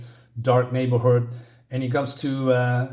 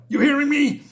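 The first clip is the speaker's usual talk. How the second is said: threatening bully shout; 13 semitones higher, 4 dB louder than usual. Retold or imitated.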